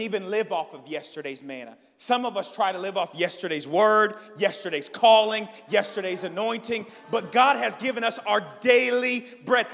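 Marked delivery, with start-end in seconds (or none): 2.0-9.7 loud, raised preacher's proclamation; full-force preaching. Real, not acted. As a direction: build to a ringing declaration for the congregation.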